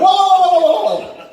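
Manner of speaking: high, loud comic panic; alarmed protest. Retold or imitated. He halts the sex talk in shock, high loud alarmed protest. Acts out his shocked reaction.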